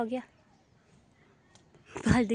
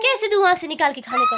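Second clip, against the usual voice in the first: exasperated; 5 semitones higher, 7 dB louder than usual. Real, not acted. Exasperated with crying toddler.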